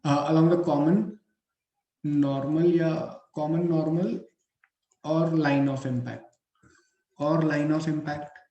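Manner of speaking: slow, measured classroom dictation; word by word, with pauses to write. Acted. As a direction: dictate in short phrases for note-taking. Dictates points for students to write.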